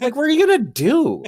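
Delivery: mock outburst. Mocking wife waking him.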